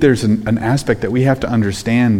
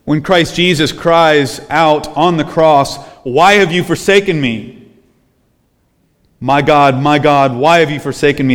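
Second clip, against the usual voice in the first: rising, urgent preacher's cry; preaching at full force; 4 semitones higher than usual. Acted. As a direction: lift the voice as you quote the cross.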